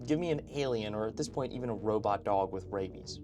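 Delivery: usual speaking voice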